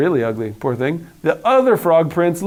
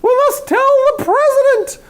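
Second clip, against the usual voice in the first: clucking hen; 3 dB louder than usual. Acted. Hen clucking her alarm to the world, high and frantic. Chicken voice continues.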